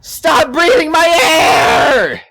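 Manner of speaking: high, loud, slow crazed scream; crazed shout. Acted. Trapped man attacking in the dark, high crazed shout. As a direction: howl it with everything you have. Climax of the struggle.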